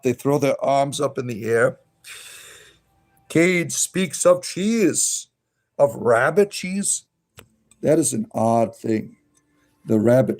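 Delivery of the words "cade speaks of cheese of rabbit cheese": slow, drawn-out reading of chat. Real, not acted. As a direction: read it out very slowly. Reading chat aloud slowly.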